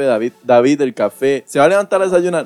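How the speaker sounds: llamado fuerte y repetido, subiendo al final; mamá llamando insistente. Retold or imitated. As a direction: imita a una mamá que apura al hijo. Madre imitada llamando a desayunar. (madre impaciente llamando a gritos moderados)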